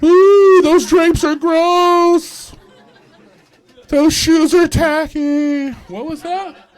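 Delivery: very high, loud, slow campy voice. Pretend. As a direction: drawl it like a catty camp ghost. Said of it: "they're just like".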